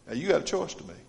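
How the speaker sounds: ordinary delivery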